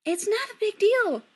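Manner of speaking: high, sugary lilt; sweetly. A narrator's reading. Mother dismissing her son's protest, sweetly. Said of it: "Kim says sweetly".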